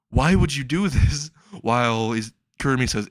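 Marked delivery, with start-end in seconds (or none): 0.1-1.3 indignant protest. Acted. Retold indignant protest to captor.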